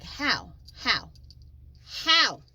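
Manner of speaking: baffled retelling, escalating to a loud, high peak; mounting disbelief. Retold or imitated. Incredulous reaction to ex's accusation.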